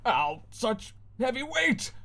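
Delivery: high slow strained groan; squashed cat. Acted. Cat squashed by the girl, groaning high. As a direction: wheeze under the weight.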